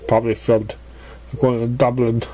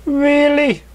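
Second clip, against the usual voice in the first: high, loud disbelieving "Really?"; surprised; 4 dB louder than usual. Acted. Pony surprised at friend's new look, high and loud. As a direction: leap up in disbelief. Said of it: "She's surprised".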